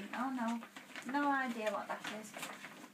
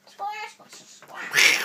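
Donald Duck voice; 12 semitones higher, 9 dB louder, much slower than usual. Shown as a demonstration. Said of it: "[name] can do Donald Duck".

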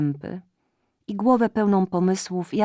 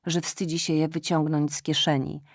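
ordinary delivery

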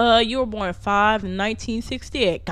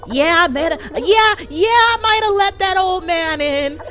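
raised, higher exasperated voice; loud exasperated admission; 5 semitones higher, 6 dB louder than usual. Real, not acted. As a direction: own up loudly to the slip. Loudly admits his game mistake.

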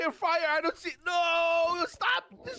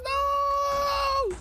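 anguished yell; 10 semitones higher, 4 dB louder than usual. Real, not acted. Base blown up by TNT.

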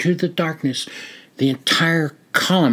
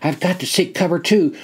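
ordinary delivery

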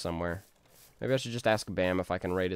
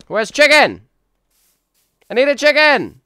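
much higher, much louder wailing cry; desperate yell; 17 dB louder than usual. Acted. Streamer wailing at the game for chicken, shrill yell. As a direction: scream it like you're starving for chicken.

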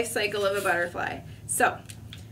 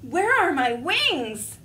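panicked caterpillar; 5 semitones higher, 3 dB louder, slower than usual. Acted.

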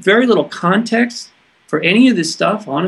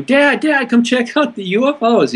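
high-pitched mock yell at normal volume; retold excited yell; 8 semitones higher than usual. Retelling yelling to dad at the house about UFOs, high excited mock yell. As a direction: call out to Dad urgently without really shouting. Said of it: "I yelled at the house".

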